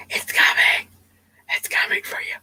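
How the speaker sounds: creepy, mock-menacing whisper. Prank caller threatening her over the phone, creepy whisper. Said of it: "It's hard to whisper for a long time".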